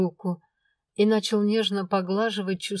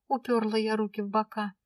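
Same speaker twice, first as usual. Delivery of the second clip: usual speaking voice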